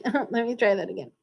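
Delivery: ordinary delivery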